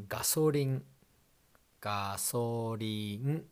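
"gasoline" as ga-so-ri-n, slowly; ガソリン; Japanese pronunciation. Shown as a demonstration. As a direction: say "gasoline" in even Japanese syllables. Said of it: "And gasoline".

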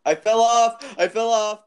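very high, loud mock wail; mimicking cry. Echoes the laughing friend's cry.

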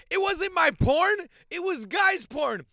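high, loud and slow, hammed-up denial; mock indignant protest. Brother denying it to his mom, mock high shriek. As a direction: shriek the denial like a wronged kid.

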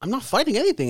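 ordinary delivery

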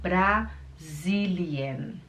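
BRA, then a pause, then long SILIEN; very slow, split "Brasilien"; syllable by syllable. Shown as a demonstration. Teacher breaking the word down for learners, slowly.

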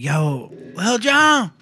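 put-on high, loud drunk shout across the club; drunken yelling. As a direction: yell his name like an old buddy. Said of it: "I'm yelling at him".

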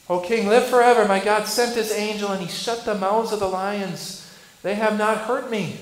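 loud triumphant answer from below; Daniel's reply. Retold or imitated. Daniel answering the king from the den, loud triumph. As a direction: proclaim it up out of the pit. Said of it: "And what did Daniel say?".

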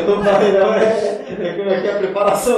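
loud words through laughter; laughing joke. Real, not acted. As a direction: crack up while saying it. Joking about a tough school.

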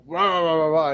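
rant, imitating getting all mad; mock angry ranting. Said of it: "and get all mad".

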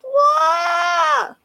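a very high, shrieking mock "What?"; a huge high-pitched parody yell of disbelief. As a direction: shriek it like an outraged wife. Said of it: "And she's like".

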